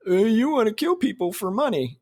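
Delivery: high, mocking put-on voice; mock dumb critic. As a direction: sneer it like a dim heckler.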